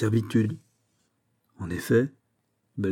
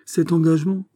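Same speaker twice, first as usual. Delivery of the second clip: higher, firm announcement; firm and resolute; 7 semitones higher, 4 dB louder than usual. A narrator's reading. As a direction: state it with firm authority. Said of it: "d'une voix ferme".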